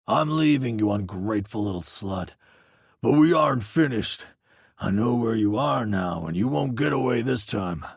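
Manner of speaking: the ex leaving with a threat, menacing and cold; threatening ex. Acted. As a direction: snarl a parting warning. Ex threatening through door.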